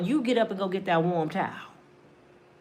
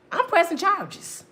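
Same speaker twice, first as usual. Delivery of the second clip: high dramatic declaration; 7 semitones higher than usual. Retold or imitated. Woman declaring it.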